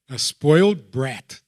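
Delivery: usual speaking voice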